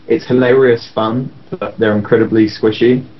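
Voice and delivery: usual speaking voice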